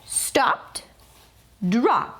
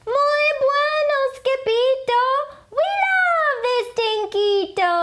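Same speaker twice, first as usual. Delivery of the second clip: much higher, louder cheer; cheering chihuahuas; 3 dB louder than usual. A narrator's reading. Chihuahuas praising Skipito, high and cheerful. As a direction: chirp it out with delight.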